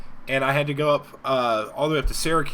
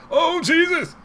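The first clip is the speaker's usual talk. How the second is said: strained exclamation; 14 semitones higher, 3 dB louder, slower than usual. Retold or imitated.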